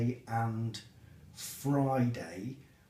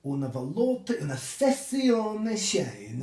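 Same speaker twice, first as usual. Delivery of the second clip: high, loud, drawn-out Italian; mock Italian accent; 5 semitones higher, 6 dB louder, slower than usual. Boss imitating the colleague Shane longs for, mock Italian accent. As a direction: put on a hammy Italian accent. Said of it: "in her best Italian accent".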